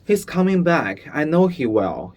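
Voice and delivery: put-on high, loud, worried boy voice; a scared child sure he'll be caught; anxious boy. A narrator's reading. Shmuel fearing the lieutenant's return, high and anxious.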